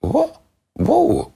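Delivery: high, startled what and whoa; shocked disbelief. Retold or imitated. Shocked high gasp at his own fans. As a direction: react to seeing your own fans shout. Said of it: "I was like".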